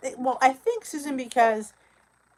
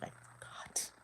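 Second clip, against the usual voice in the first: whispered aside; 19 dB quieter than usual. Real, not acted. Guilty aside about missing meeting.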